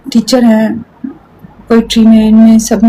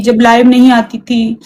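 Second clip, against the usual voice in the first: usual speaking voice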